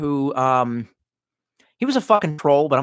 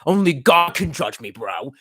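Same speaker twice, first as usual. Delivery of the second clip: tough bro; slower than usual. Retold or imitated. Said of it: "now I sound like I should get some".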